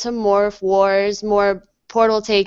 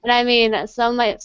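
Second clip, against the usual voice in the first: normal talking voice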